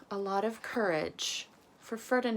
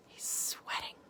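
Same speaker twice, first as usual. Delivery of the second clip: soft unvoiced whisper; hushed whisper about the picture; whispered aside; 8 dB quieter than usual. Real, not acted.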